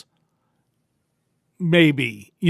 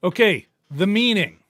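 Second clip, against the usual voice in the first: normal talking voice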